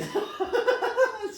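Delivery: high, loud burst of real laughter; big belly laugh at the memory. Real, not acted. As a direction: crack up hard at yourself. Laughing hard at own story.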